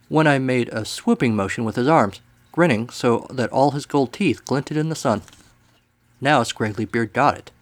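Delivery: ordinary delivery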